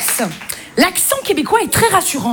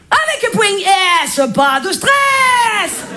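hammed-up Marseillais slogan, last word stretched out; slow, drawn-out Marseille accent; much slower than usual. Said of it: "l'accent marseillais se suffit à lui-même".

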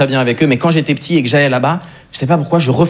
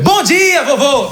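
cheerful greeting; 12 semitones higher, much slower than usual. Retold or imitated. Greeting grandfather in Portuguese.

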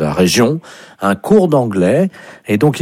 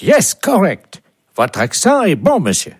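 put-on English caller; 6 semitones higher than usual. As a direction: praise the pupil in a high English accent. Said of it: "vous serez appelé par un Anglais". (faux Anglais à voix aiguë)

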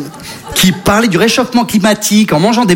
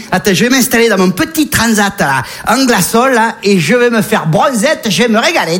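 put-on Marseille accent; 2 semitones higher than usual. Polar bear with a Marseille accent settling on his deckchair. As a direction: stretch out the words in a Marseille accent like lounging on a deckchair. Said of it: "l'accent marseillais".

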